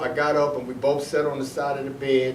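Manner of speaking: ordinary talk, nothing marked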